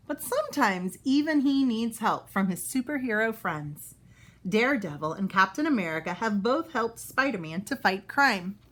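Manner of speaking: usual speaking voice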